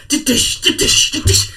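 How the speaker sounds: loud bouncy da-dish thumps; a bouncing flame creature thudding along; bouncing sound effect. Acted. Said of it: "bounces after you, like".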